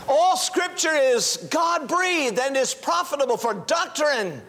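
fervent sermon push; preaching. Acted. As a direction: urge the congregation to act. Preacher's exhortation.

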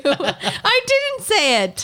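high, slow whiny denial; drawn-out high denial. Acted. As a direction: stretch it out, pitch way up.

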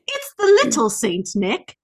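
usual speaking voice

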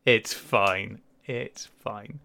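ordinary delivery

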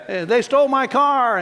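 high-pitched imitation of an upset resident; complaining resident. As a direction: cry out a complaint as a confused resident. Voicing agitated care home residents.

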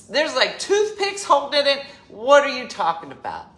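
ordinary talk, nothing marked

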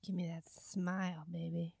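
whispered creepy leer. Retold or imitated. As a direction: breathe it like a sleazy creep. Mocking the creepy customer.

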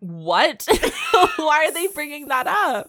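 loud, high, laughing disbelief; incredulous. Real, not acted. Host baffled by the book's cartoon reference, loudly incredulous. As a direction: burst out baffled, half laughing.

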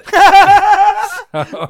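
loud, high burst of laughter; big laugh. Real, not acted. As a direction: crack up at the joke. Co-host cracks up at a pun.

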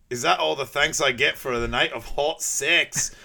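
hammed-up Fat Bastard bragging; a boastful brute giving it the full ham. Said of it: "meet fat bastard ... he goes".

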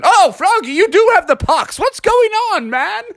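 very high, startled exclamation; shocked dad. Acted. Dad sees his son has the pox, high shocked outcry. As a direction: exclaim in high-pitched disbelief.

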